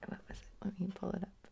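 muttered whisper to herself. Real, not acted. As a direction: whisper it while reading. Muttering while checking prompt.

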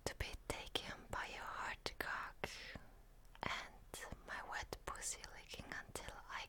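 very soft explicit whisper; breathy whisper. Acted. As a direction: whisper it close and intimate. Explicit intimate roleplay whisper.

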